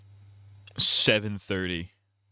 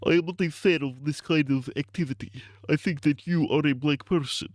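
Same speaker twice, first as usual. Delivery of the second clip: higher, odd put-on official voice; mock stiff voice; 6 semitones higher than usual. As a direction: play a stiff formal speaker. Acted robotic quote.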